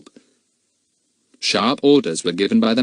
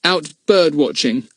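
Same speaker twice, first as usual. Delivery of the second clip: sullen; 7 semitones higher, 4 dB louder, slower than usual. A narrator's reading. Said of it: "said Philip sullenly".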